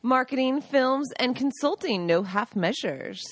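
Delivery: hammed-up ad-read delivery; radio voice. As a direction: put on a radio voice, drawing out the last phrase. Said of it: "Doing the radio voice again".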